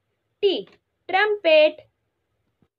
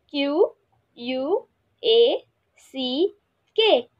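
ordinary talk, nothing marked

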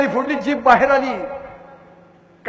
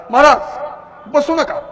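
loud, raised-pitch urgent plea; acted warning shout; 4 semitones higher, 6 dB louder than usual. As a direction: shout a warning as you come running. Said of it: "मोठ्या मोठ्याने ओरडत आले". (ओरडून घाईने सावध करणे)

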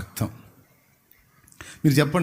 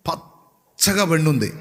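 slow, drawn-out పచ్చగా పండుంది with a falling end; slow, marvelling పచ్చగా పండుంది; slow emphasis; slower than usual. Shown as a demonstration. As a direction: savour పచ్చగా పండుంది slowly. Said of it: "ఒక్క పొలం మాత్రం".

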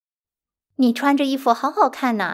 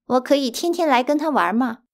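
usual speaking voice

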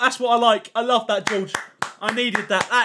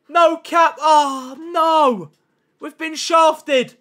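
loud, high despairing groan, slow at the end; dismayed; 4 semitones higher, 4 dB louder, much slower than usual. Real, not acted. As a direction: lament being wronged by fate. Stream crash despair.

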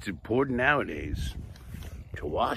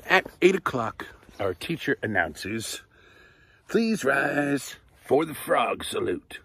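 lively, bright read-aloud storytelling; reading to children; 3 semitones higher, 4 dB louder than usual. Acted. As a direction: read it like story time for little kids. Reads picture book aloud.

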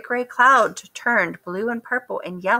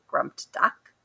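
ordinary delivery, nothing marked

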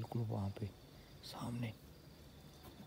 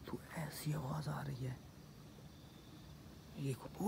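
whisper; 3 semitones higher, 10 dB quieter than usual. Real, not acted. Whispering about a strange noise.

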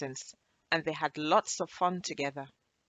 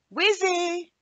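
high, loud call across the house; 13 semitones higher, 8 dB louder than usual. Acted. Anna calling loudly through the house for her lost friend. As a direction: call her name to find her. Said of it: "she called".

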